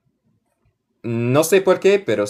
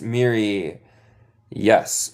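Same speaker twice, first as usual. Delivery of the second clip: normal talking voice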